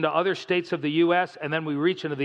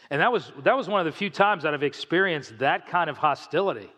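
ordinary delivery

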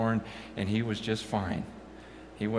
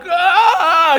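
high, loud wailing "ah"; loud anguished wail; anguished cry out; 17 dB louder than usual. Retold or imitated. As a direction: let out a cry of anguish before God. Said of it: "then I'm like".

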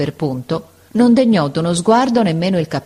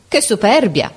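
indignant outburst; 5 semitones higher, 3 dB louder than usual. Acted. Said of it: "pien di rabbia".

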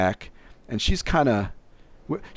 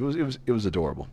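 ordinary talk, nothing marked

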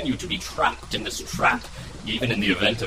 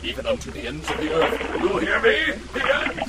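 shouted villain vow; 6 semitones higher than usual. Acted. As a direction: bellow the vow of revenge after him. Villain vows revenge shouting.

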